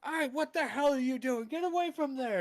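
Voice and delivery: high furious shout at the helmsman; captain yelling. Acted. Captain yells at Bastion at the helm, furious high shout. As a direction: bellow it in outrage, way up high. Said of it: "looks back at him and goes".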